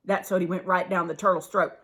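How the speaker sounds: plain reading voice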